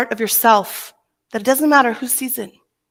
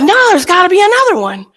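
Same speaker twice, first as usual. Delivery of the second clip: psychic insisting; 9 semitones higher, 5 dB louder than usual. Retold or imitated. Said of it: "He goes like".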